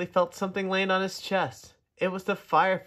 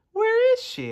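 eager question; 6 dB louder than usual. Acted. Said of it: "asked Tudley".

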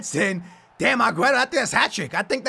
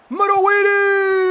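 high, loud, stretched-out yell; long goal cry; excited goal shout; 7 semitones higher, 5 dB louder, slower than usual. Real, not acted. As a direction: shout the player's name and hold the last vowel. Calling out as player scores.

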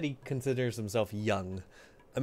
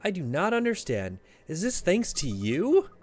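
normal talking voice

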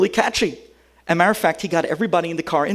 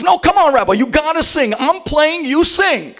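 eager urging; 8 semitones higher than usual. Retold or imitated. Kid urging rabbi to sing.

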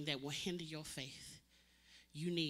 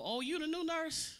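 mock staff greeting; 12 semitones higher, 3 dB louder than usual. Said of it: "Go to another floor".

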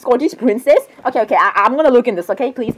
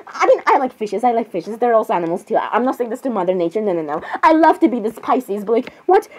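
usual speaking voice

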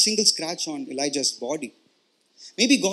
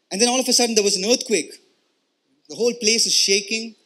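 louder, building the storm and quake; dramatic sermon storytelling; 4 dB louder than usual. Acted. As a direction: preach it with rising drama. Dramatic retelling of storm, earthquake.

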